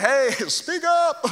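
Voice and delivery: high, drawn-out jeering call; irreverent heckling. Retold or imitated. Elijah taunting silent Baal's prophets, irreverent heckling. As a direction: heckle them, nothing solemn about it. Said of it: "he's not trying to be, like, biblical".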